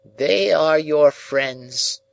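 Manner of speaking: put-on high, slow, cold old lady's voice; old Miss Havisham. A narrator's reading. Miss Havisham coldly tells Pip who his friends are, high and slow. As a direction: voice the aged jilted bride, slow and high.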